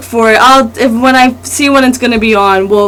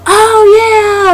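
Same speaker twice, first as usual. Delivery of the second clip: mock realisation; 10 semitones higher than usual. Mimicking slow realisation, voice breaking.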